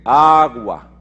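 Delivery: put-on a loud, clear "Agua" for the baby; calling a word to a baby. Shown as a demonstration. Teaching a baby its first word, loud and clear. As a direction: call out "Agua" so the baby hears it. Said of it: "A usted le hablan y usted es un bebé y le dicen".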